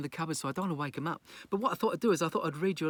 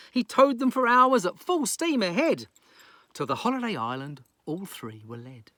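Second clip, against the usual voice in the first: lively story narration; 2 semitones higher, 7 dB louder than usual. Acted.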